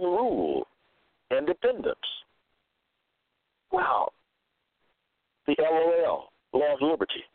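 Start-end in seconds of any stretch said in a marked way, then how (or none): 3.7-4.1 a very high amazed wow. Real, not acted. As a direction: let the wow leap up in wonder. Reacting to the definition of liberty.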